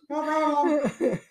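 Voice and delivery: high, slow put-on Bruce voice. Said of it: "Thanks, Bruce".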